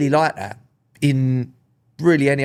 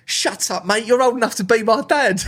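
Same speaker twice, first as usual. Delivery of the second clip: mock teen jibe; 9 semitones higher than usual. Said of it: "He went".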